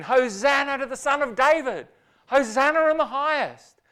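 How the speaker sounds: high, loud jubilant shout; shouting praise. Retold or imitated. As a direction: shout it like a cheering crowd. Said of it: "And they start shouting".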